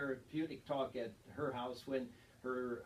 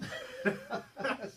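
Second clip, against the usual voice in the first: breathy laugh; 9 semitones higher, 4 dB louder than usual. Real, not acted. Cohost laughs at grocery note.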